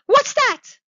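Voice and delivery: high, loud alarmed shriek; startled cry. A narrator's reading. Old woman starting up in bed at the knock, startled cry. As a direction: burst out in sudden fright, high and loud. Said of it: "cried the old woman, starting up".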